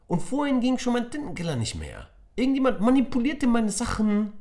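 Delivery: put-on high, loud angry child voice; schoolboy raging about sabotage; Tom fuming. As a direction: accuse the room furiously. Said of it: "fauchte Tom".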